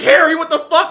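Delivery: high, loud startled shout; startled yell. Retold or imitated. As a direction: imitate a friend caught off guard, yelling. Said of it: "he's, like, freaking out".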